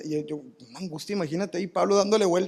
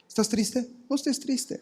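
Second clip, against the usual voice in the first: high sing-song mock of a friend, much higher; mocking empty advice; 9 semitones higher than usual. Friend offering shallow advice to someone sad, mock sing-song. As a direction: parody a shallow comforter in a high voice. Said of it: "el consejo de ese amigo".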